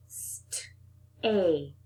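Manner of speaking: St-age with the st split from age; split apart. Shown as a demonstration. Teacher breaks the word apart for the children. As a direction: hold St, then age. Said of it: "break apart that st".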